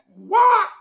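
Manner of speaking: high, loud parrot squawk of surprise; squawking bird. Acted. Bird squawking at the baseball.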